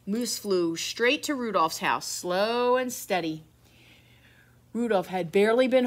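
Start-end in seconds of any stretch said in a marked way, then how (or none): none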